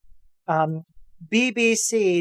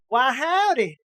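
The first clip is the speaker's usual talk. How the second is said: high, hammed-up Southern cowboy twang; mock Southern accent; 8 semitones higher than usual. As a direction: imitate a wildly wrong Texan accent for an Osaka cook. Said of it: "wildly inappropriate accents".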